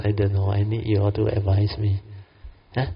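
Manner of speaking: normal talking voice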